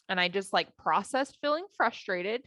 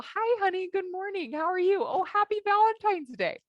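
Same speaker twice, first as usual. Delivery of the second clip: much higher, sugary sing-song hello; mock sweet greeting; 9 semitones higher than usual. Wife pretending to greet her husband on Valentine's Day, mock sweet. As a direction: put on a high, cheery wifely greeting.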